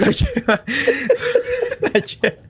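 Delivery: laughing. Real, not acted. Laughing while describing phallus parade.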